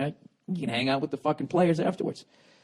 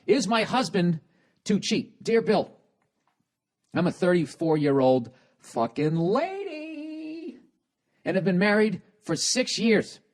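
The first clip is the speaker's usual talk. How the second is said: higher, slow reading of the woman's letter; mock lady letter voice; 7 semitones higher, slower than usual. Host reading wife's letter in lightly higher voice. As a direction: read it as the letter-writing wife.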